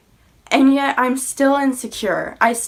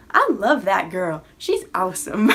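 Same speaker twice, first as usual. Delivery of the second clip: lightly voiced God with a playful accent; a quick jokey accent for God's praise; put-on accent for God; 2 semitones lower than usual. Speaker imagining God praising her, in a light joking accent. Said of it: "Probably not with that accent".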